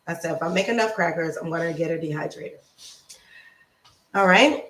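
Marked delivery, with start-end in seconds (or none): 0.1-2.6 fast, softer rushed restatement; quick correction. Real, not acted. Host correcting herself about crackers, fast. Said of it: "I'll make enough crackers".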